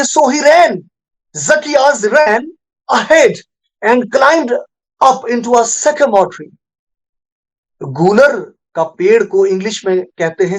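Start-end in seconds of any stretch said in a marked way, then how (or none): 0.0-6.6 higher-pitched, emphatic reading in separate phrases; scripture reading. Acted. Preacher quotes English Bible to congregation, emphatic scripture reading. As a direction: read the verse out phrase by phrase, pitched up.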